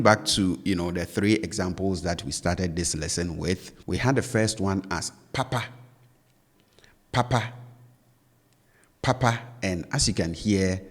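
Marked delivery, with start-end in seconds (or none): 5.3-9.4 "papa" with both syllables held high. Shown as a demonstration. Teacher showing learners the first Twi word, high-high tone on "papa". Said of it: "tone with which I pronounce both syllables are up".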